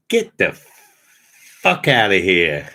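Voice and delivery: hammed-up rough street talk, half joking; mock Brian. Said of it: "as Brian probably say".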